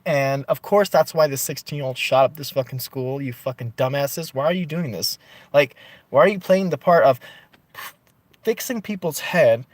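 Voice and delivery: normal talking voice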